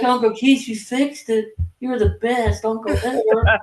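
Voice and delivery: put-on high, gushing, adoring girl voice; hammed-up grateful niece, suggestively sweet.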